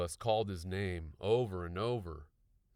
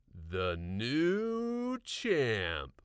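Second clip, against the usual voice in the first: smug boast; 4 semitones higher, 5 dB louder, much slower than usual. A narrator's reading. Grinning boast.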